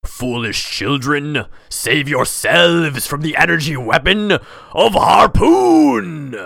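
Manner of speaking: slow, loud, grandiose villain shout; booming villain voice. Acted. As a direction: proclaim it like a cartoon villain. Villain announces attack.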